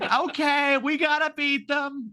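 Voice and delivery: high, put-on Sarah Palin voice; high pitched mock Palin; imitating Palin. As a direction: play her bluffing along cheerfully. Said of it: "She's like".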